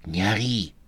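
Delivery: soft urgent hushing; hushed hiss. A narrator's reading. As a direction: hush him without being heard. Vika hushes Andrey.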